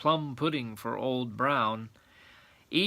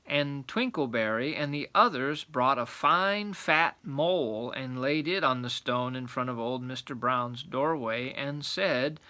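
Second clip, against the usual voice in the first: ordinary delivery, nothing marked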